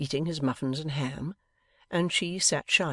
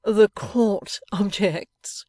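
high, loud mock-judicial announcement, very drawn out; laughingly; 7 semitones higher, 8 dB louder, much slower than usual. A narrator's reading. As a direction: playfully mimic a court objection, no actual laugh. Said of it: "turned to him laughingly".

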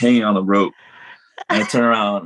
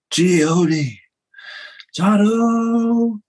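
high drawn-out announcer call; ring announcer call; 13 semitones higher, much slower than usual. Retold or imitated. Announcer calling out team names, high drawn-out call. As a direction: belt the name like a ring announcer. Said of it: "so they go".

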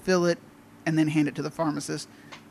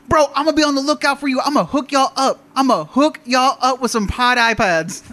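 high, loud, emphatic promise; eager hustler customer; 8 semitones higher, 6 dB louder than usual. Retold or imitated. Customer offering hot iPads to pharmacy staff, loudly chummy. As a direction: draw out the last line as a big promise.

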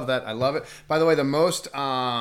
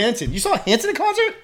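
high, loud disbelieving laugh; surprised; 13 semitones higher, 11 dB louder than usual. Real, not acted. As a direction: gasp out the question in disbelief. Astonished at Hanson concert.

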